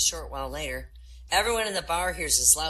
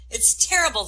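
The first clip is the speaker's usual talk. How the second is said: higher, louder mock bellow; bellowing lady; 11 semitones higher, 6 dB louder than usual. Angry patient bellows at her doctor, loud and high. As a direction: bellow it out in outrage. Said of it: "the lady bellows".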